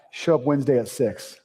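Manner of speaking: ordinary delivery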